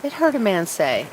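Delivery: plain reading voice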